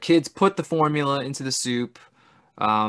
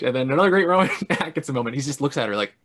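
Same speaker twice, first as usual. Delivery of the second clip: usual speaking voice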